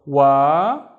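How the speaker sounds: "Wa" stretched out, loud; long vowel. Shown as a demonstration. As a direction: hold the "a" in "Wa". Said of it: "we will always see long vowels".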